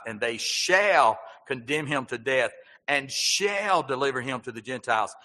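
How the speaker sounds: slow proclaiming read, "shall" punched each time; stress on shall. Shown as a demonstration. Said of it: "did you see my emphasis I put on the word shall".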